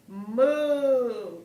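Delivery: a long, loud moo like a cow. Shown as a demonstration. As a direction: moo for the kids like a real cow. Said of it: "What sound do cows make?".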